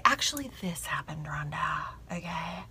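very soft, near-whisper; whispered correction. Pretend. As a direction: imitate someone discreetly correcting her. Voices a viewer's gentle correction.

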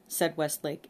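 ordinary delivery, nothing marked

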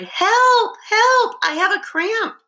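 very high, loud panicked cry; crying for help. A narrator's reading. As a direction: cry out in sudden alarm. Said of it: "Suddenly she cried".

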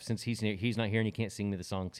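ordinary talk

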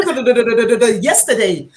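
normal talking voice

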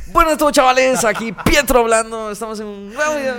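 high, loud YouTuber greeting; mock over-excited channel greeting; vlogger intro. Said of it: "¿Cómo era el saludo?".

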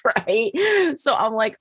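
normal talking voice

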